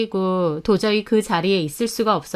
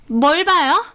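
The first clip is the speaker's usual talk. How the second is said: higher, defensive challenge; snappish; 7 semitones higher, 4 dB louder, slower than usual. Acted. Shop owner caught hiding her notebook, snappish. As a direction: snap it defensively, pitched up.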